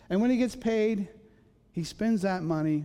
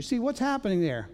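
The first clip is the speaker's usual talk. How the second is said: ordinary delivery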